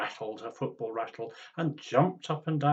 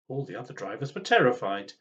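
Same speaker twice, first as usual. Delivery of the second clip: ordinary delivery, nothing marked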